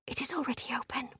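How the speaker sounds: very soft, hushed report; whispered. A narrator's reading. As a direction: whisper it so no guard hears. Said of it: "she whispered".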